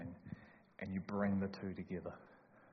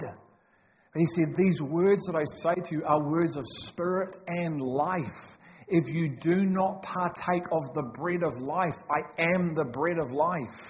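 loud, raised, emphatic preacher; forceful preaching; 5 semitones higher, 8 dB louder than usual. Real, not acted. Preacher challenging the church on living bread, full-force preaching. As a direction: drive each phrase home loudly.

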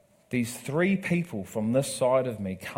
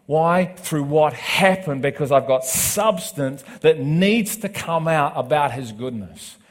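preaching; 3 semitones higher, 7 dB louder than usual. Acted. Pastor preaching to the congregation with rising force. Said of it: "And I'm just preaching".

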